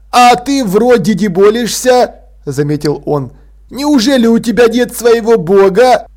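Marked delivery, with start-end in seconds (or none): 0.1-2.1 put-on high, slow boy with blocked nose; raised, bunged-up character voice; stuffed-nose voice. A narrator's reading. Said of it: "звучно высморкался". 3.7-6.1 put-on stuffed-nose boy. A narrator's reading.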